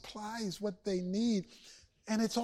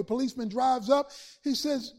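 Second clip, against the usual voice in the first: ordinary delivery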